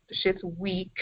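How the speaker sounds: "weak" given its own emphatic beat, voice dropping away; stress on weak. Real, not acted. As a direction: lean hard on the word "weak". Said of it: "You put emphasis on that weak".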